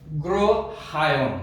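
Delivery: অগ্রহায়ণ said very slowly, syllable by syllable; অগ্রহায়ণ pronounced slowly and carefully; slow pronunciation. Shown as a demonstration. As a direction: stretch out অগ্রহায়ণ for the pupils. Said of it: "উচ্চারণটি হবে".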